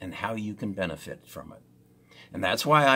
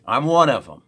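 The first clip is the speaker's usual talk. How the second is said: loud, punchy declaration; loud proud admission; emphatic declaration; 4 semitones higher, 11 dB louder, faster than usual. Real, not acted.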